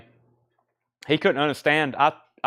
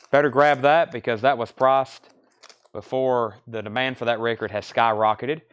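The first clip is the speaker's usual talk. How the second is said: ordinary talk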